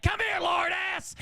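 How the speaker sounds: high-pitched mock furious yell; screamed summons. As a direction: imitate a mother going after her son. Mom screaming at son.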